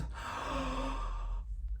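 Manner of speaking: a hammed-up high gasp; mock gasp. Vlogger mocking Sutan's shock, hammed-up gasp.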